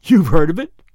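loud, rising question of surprise; sudden interest. A narrator's reading. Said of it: "sudden interest".

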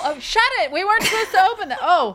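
high, loud, fast flustered voice; mock panicked scold. Host playing a mailer who messed up, high and flustered. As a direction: bicker like a caught idiot.

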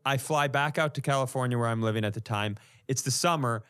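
ordinary talk, nothing marked

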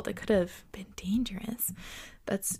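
soft, breathy whisper; whispered aside. Real, not acted. Aside on canal fall.

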